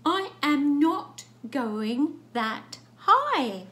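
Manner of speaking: loud, slow, high-pitched refusal; stubborn cat. Acted. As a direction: dig in and wail every word out. Cat keeps refusing in story.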